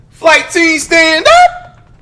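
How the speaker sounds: high, loud rallying call; hype shout. Real, not acted. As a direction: pump up the crowd, shouting. Hype call to his viewers.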